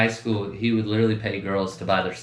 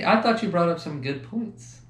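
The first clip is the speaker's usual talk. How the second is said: ordinary talk, nothing marked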